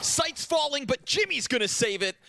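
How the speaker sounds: high-pitched burst of live commentary; excited play-by-play. Acted. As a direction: call a sudden turn in the match. Live esports play-by-play, key moment.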